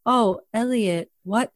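monotone, blank line reading; Zooey Deschanel. Retold or imitated. Mocking her next scene with Elliot, monotone. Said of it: "she'd be monotone".

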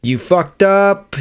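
put-on slow, drawn-out Bronx accent; Harvey Keitel Bronx accent. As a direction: lean into a tough Bronx accent, slowly. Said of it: "That's my best Bronx accent".

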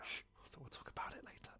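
hushed urgent whispering; whisper yelling. Real, not acted. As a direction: whisper like sharing a secret. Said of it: "Why are we whispering?".

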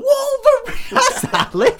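high-pitched, very loud bellow; mind-losing yell. Acted. Texas guy confronts Wolverine outside the car, unhinged hammed-up yell. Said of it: "This guy loses his mind every time".

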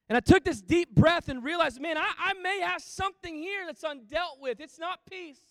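loud, high, urgent realization; impassioned testimony. Real, not acted. Pastor telling the congregation his breakthrough, loud and high. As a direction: pour it out at the peak.

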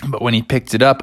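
usual speaking voice

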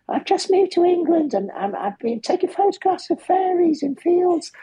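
very high falsetto impression; Dylan's high voice. Musician telling a fan about photographing fairies, high falsetto voice. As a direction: hold a put-on squeaky head voice throughout. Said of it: "It's really high voice".

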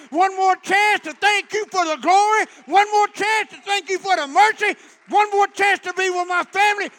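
loud, high thanks building to a shout; impassioned preached prayer. Real, not acted. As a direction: cry out gratitude to God in driving repeated phrases.

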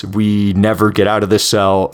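ordinary delivery

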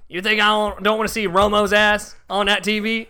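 put-on high-pitched country-accent rant; hammed-up country accent. Homeowner about Romo on TV, indignant country accent. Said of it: "went through so many different accents".

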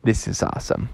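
soft hushed whisper; awed whisper. Acted. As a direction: breathe it out in wonder. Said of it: "Sabor was like".

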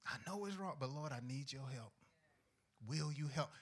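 quiet plea. Pretend. Humbled believer asking God for help, soft. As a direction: ask softly for help like you mean it.

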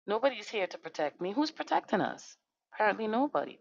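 ordinary delivery

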